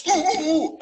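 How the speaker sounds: very high excited ooh-oohs with a laugh; mute eager customer. Retold or imitated. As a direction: squeal eagerly instead of speaking. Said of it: "and I go".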